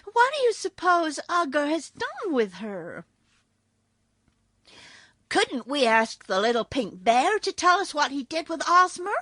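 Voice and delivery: put-on little girl voice. A narrator's reading. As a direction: ask like an eager young girl. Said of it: "asked Button-Bright".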